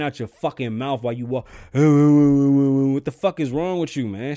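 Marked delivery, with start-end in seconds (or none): 1.7-4.4 louder, slowed-down disbelieving yell; furious slow yell. Acted. At the guy on his phone in the store, slow furious yell. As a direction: spit out each word at the shopper. Ranting at rude shoppers.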